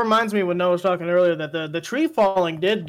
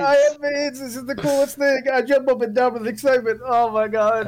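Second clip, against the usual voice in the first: high-pitched, put-on giddy voice; hammed-up excitement; 4 semitones higher than usual. Replaying the joke lines for friends, hammed-up excitement. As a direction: keep the mock excitement high all through. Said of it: "I wanna do it more".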